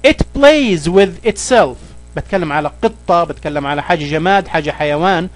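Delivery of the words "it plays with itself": slow, careful reading of the English example. Shown as a demonstration. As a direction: read each word slowly for the learner. (قراءة بطيئة وواضحة للمثال)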